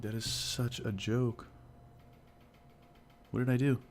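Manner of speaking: ordinary talk, nothing marked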